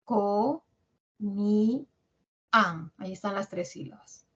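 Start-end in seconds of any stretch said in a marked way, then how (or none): 0.0-2.9 "Co, mi, an" as three separate syllables; long pauses between "Co", "mi" and "an". Shown as a demonstration. Teacher splitting comían for students, "Co, mi, an" syllable by syllable. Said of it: "¿cuáles son las sílabas?".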